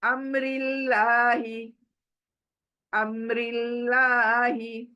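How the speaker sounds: slow अम्रिल्लाही with a light, thin लाम; thin Allah. Shown as a demonstration. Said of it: "अमरी जेर की वजह से अल्लाह खींच कर पढ़ेंगे".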